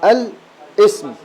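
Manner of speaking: الاسم drawn out very slowly, syllable by syllable; slow demonstration of الاسم; slow sounding out. Shown as a demonstration. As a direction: spell out الاسم slowly for the student. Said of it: "صح؟".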